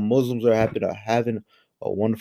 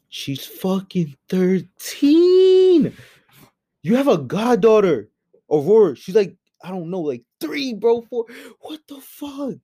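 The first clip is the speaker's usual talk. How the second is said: outraged rant; 6 semitones higher than usual. Real, not acted. Vlogger yelling at a disgraced YouTuber about a child, outraged. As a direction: hammer home how wrong it is.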